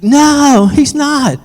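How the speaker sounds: high, loud wailed denial; mimicked child's desperate shout; frantic little girl. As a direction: cry it out like a child needing rescue. Acted girl answering her rescuer.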